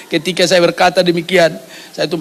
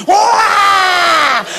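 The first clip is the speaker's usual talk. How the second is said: high, long imitated baby wail; mimicked piercing infant cry; baby's wail; 9 semitones higher than usual. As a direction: bawl "waah" like a newborn. Imitates the baby still crying.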